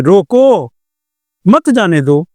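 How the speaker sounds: روکو, full stop, then مت جانے دو together; pause after روکو. Shown as a demonstration. Teacher showing an order to stop, clear break after روکو. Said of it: "روکنے کا حکم".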